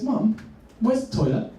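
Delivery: boy in restaurant asking mum, lightly voiced child; little boy. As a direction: pipe up like a small boy. Seven-year-old asks his mum.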